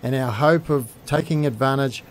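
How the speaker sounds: low, measured travel-show narration; documentary voiceover. Acted. Host telling viewers about the hatchery visit, measured voiceover. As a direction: guide viewers through the trip in an even read.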